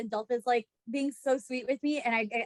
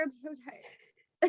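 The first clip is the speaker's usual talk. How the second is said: much softer, very slow, drawn-out tired moan; mock tired whine; 12 dB quieter, much slower than usual. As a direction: drag it out like you're exhausted. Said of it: "He's like".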